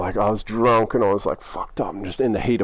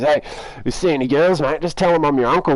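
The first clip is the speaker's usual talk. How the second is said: put-on old trucker voice; 6 semitones higher, 4 dB louder than usual.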